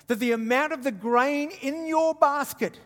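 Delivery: ordinary talk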